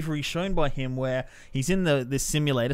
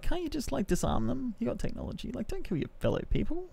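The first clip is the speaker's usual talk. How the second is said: hushed exasperation; 9 semitones higher, 9 dB quieter than usual. Retold or imitated. Exasperated aside at the film.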